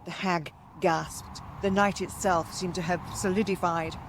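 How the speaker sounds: plain reading voice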